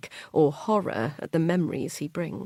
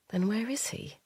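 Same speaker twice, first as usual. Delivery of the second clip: hushed worry; 4 semitones higher, 6 dB quieter than usual. Acted. Worried inner thought.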